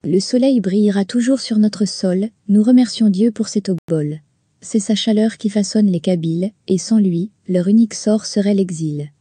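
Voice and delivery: measured, earnest reading of verse; lightly declaimed poem; poem recitation. Acted. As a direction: recite each line like a heartfelt hymn.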